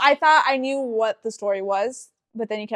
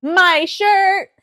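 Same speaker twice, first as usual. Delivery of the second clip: high, loud joking outburst; loud, high-pitched mock announcement; excited exclamation; 9 semitones higher, 9 dB louder than usual. Real, not acted. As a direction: blurt it out as a big comic reveal. Springs a joke reveal on co-host.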